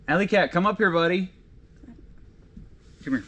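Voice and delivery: high, lifted cat-calling voice; sing-out call to a buddy cat; calling a pet. Real, not acted.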